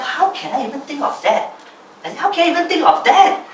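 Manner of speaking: high-pitched disbelief at one's own thought; re-enacting a sudden, incredulous realisation; astonished self-questioning. Telling oneself off after reading the journal, astonished. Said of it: "suddenly I tell myself".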